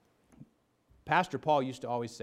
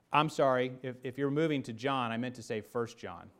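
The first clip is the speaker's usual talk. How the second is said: ordinary talk, nothing marked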